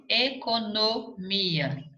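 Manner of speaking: "economia" drawn out slowly in separate syllables. Shown as a demonstration. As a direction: break "economia" into slow syllables to check the accent. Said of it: "qual que não tem acento aqui?".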